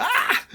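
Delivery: high squeaky yelp with a laugh; startled yelp. Retold or imitated. Bobby yelps as the door swings open. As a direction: yelp as if caught off guard.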